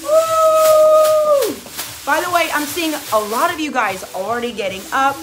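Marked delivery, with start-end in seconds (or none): 0.0-1.5 long high, loud "woo"; sustained high-pitched cheer; excited whoop. Real, not acted. Cheering with pom-poms in hand.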